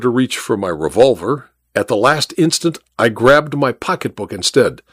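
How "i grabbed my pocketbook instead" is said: ordinary delivery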